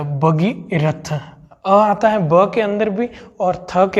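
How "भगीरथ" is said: Bhagirath spelled out slowly, short a both ends; slow model word. Shown as a demonstration. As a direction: drag out Bhagirath syllable by syllable. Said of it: "आगे भी अ और पीछे भी अ".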